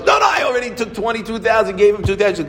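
high, loud protest in one rush; retold alarmed interjection. Cashier cutting in on owner and rabbi, high loud objection. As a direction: chime in urgently with a high voice.

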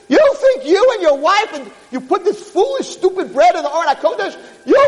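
high-pitched, outraged scolding shout; furious tirade. Acted. Rabbi berates peasant for feeding God bread, furious tirade. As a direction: berate him with drawn-out, incredulous outrage.

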